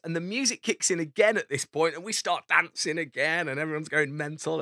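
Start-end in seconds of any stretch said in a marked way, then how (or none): none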